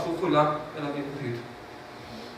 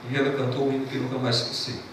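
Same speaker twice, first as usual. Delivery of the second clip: ordinary delivery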